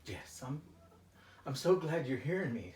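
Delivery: normal talking voice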